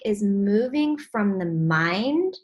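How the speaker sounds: normal talking voice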